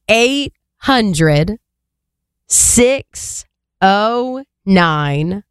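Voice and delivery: very slow, each digit drawn out; dialing pace. Shown as a demonstration. Co-host giving the number for dialing, exaggeratedly slow. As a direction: spell out the number slowly with pauses between groups. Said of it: "tell you the number at a dialing pace".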